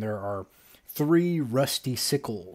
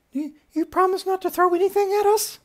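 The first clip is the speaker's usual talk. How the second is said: very high, loud tiny squeak; little voice; 7 dB louder than usual. Acted. Little creature seeking a promise from Blue, high squeaky voice. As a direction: ask it in a piping falsetto. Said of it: "the little voices says".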